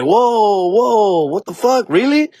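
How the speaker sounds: can't believe the rule; mock-shocked customer hearing first come, first serve; shocked disbelief.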